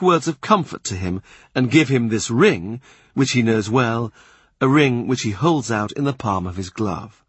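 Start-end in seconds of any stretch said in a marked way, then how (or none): none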